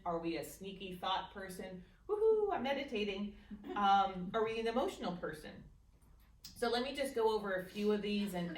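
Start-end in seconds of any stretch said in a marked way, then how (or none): 2.1-3.3 mock excited cheer. Mock cheer about meditating.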